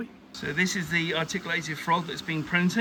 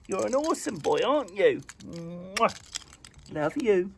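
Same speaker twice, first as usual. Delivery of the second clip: talking to a dog; 4 semitones higher than usual. Real, not acted. Talking to pets.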